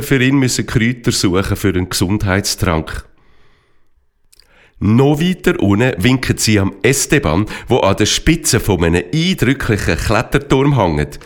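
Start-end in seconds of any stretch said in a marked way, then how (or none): none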